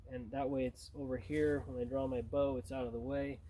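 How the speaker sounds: normal talking voice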